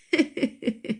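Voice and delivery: short acted chuckle; acted laugh. Loza laughs at her sister-in-law, amused chuckle. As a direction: let out a brief giggle in character.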